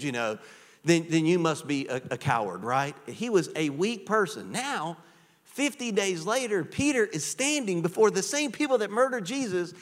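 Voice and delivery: usual speaking voice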